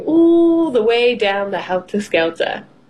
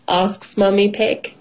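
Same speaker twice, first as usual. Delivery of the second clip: usual speaking voice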